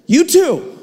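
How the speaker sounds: high, quick, chirpy "You too"; awkward reflex reply. Retold or imitated. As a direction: blurt it out on autopilot. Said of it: "And I say".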